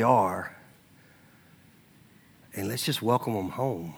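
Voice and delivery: soft, low, with long pauses; gently plead, letting each phrase settle; hushed, unhurried pastoral appeal; tender appeal. Real, not acted. Tender appeal to accept people.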